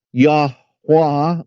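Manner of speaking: Yahweh said as "YAH-wah"; variant pronunciation. Shown as a demonstration. Teacher giving another form of the name, Yahweh as "YAH-wah". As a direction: end Yahweh on an open "wah". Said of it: "It is also pronounced".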